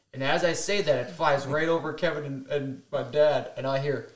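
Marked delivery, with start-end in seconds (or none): none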